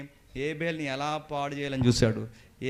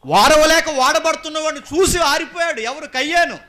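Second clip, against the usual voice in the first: very high, loud preaching cry; full-force shout; 16 dB louder than usual. Acted. Preacher repeating why Cain's light went out, shouted. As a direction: shout the whole point out in one go.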